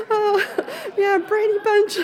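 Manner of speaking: high, giggly yeah, Brady Bunch; laughing at being called Brady Bunch. Real, not acted. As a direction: laugh through the words. Laughing at the nickname.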